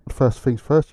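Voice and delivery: ordinary delivery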